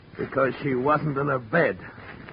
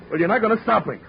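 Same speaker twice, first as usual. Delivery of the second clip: higher and louder, flaring up; defiant outburst; 5 semitones higher, 5 dB louder, faster than usual. Acted. Escaped man shouts defiance at the detective, agitated. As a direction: lash out, nobody's stopping you.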